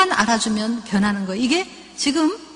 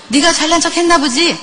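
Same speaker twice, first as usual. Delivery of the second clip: higher, louder mocking jab; mock snide mother, higher and louder; sarcastic jab; 5 semitones higher, 6 dB louder than usual. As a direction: needle the daughter. Imagined sarcastic retort to daughter.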